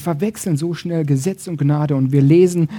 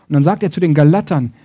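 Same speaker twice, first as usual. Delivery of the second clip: ordinary talk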